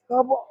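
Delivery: pained groan after a hard set; strained groan. Real, not acted. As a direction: let out a sore groan.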